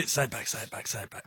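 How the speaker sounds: quiet muttering. Real, not acted. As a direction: trail down very soft and low. Mock pleading after hang-up.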